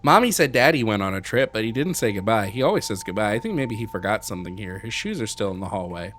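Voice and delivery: reads the child's diary aloud, lightly voiced; a child's voice reading a sad diary. Streamer reads a creepy game diary, a child's voice.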